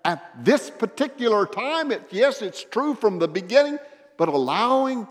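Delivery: high, loud, urgent pleading; impassioned shout. Acted. God to us reading the Bible, impassioned shout. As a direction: cry out to be heard, pressing every phrase.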